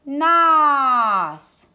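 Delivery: ANANAS as long, loud A, NA, NAS; drawn-out syllables. Shown as a demonstration. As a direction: stretch every A in ANANAS. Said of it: "Où est-ce que j'entends le A?".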